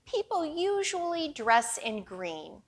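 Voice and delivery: ordinary delivery, nothing marked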